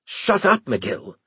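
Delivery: sharp high barked order. Acted. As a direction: bark it at him. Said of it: "Winslade barked".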